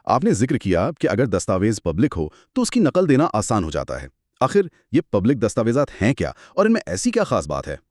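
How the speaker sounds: usual speaking voice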